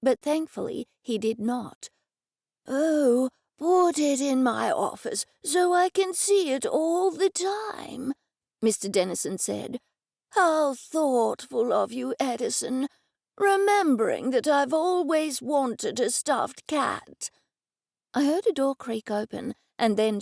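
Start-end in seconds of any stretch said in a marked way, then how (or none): 2.7-8.1 put-on slow, shaky old man voice; the old office owner welcomes Edison, slow and quavering; elderly man. Old Mister Dennison voiced. 10.3-17.3 put-on slow, shaky old man voice; the old office owner welcomes Edison, slow and quavering; elderly man. Old Mister Dennison voiced.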